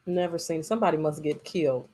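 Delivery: ordinary talk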